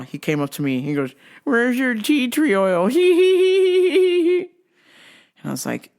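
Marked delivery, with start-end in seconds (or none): 1.4-2.9 mock customer, higher and slow. Worker mimicking customer asking for tea tree oil. 2.9-4.4 high mock customer giggle, louder; squeaky little giggle. Worker mimicking customer's giggle, high.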